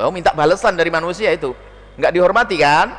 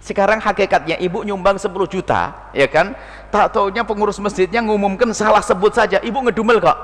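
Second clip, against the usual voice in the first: usual speaking voice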